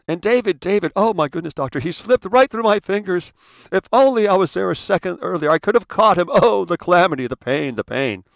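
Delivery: high, loud theatrical lament fading at the end; mother performing grief for the doctor; melodramatic mother. Retold or imitated. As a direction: overplay the grieving mother, then sink. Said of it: "the story that she tells the doctor".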